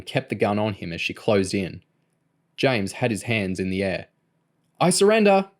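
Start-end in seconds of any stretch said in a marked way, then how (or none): 4.8-5.5 high, loud plea; desperate surrender. A narrator's reading. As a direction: cry out in surrender. Shot boy gives himself up.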